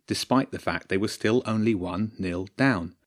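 ordinary delivery, nothing marked

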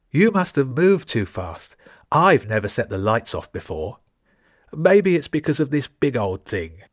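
hint of a Russian giant of a boy; Russian accent. A narrator's reading. Kolya answering the other boy in a Russian accent. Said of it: "his Russian accent giving him away".

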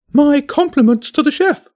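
very high, loud, gracious bird squawk; posh bird voice. A narrator's reading. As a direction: squawk it like a pompous parrot. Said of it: "the bird said".

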